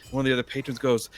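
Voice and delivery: normal talking voice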